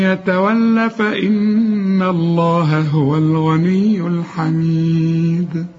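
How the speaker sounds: slow, chanted recitation; Quran recitation. Acted. Teacher recites the verse before the lesson. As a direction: recite slowly, melodic and measured.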